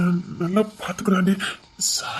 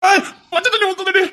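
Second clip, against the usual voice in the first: high yelping protest from cold water; yelp, gasping at icy water; shocked yelp; 11 semitones higher than usual. Acted. Husband doused with cold water. (குளிர் நீரில் அலறல்)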